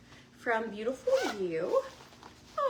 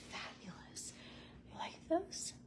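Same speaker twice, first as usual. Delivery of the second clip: much softer, unvoiced; awed whisper; 6 semitones higher, 9 dB quieter than usual. Real, not acted. Admiring the sunglasses, an awed whisper. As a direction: breathe it out in awe.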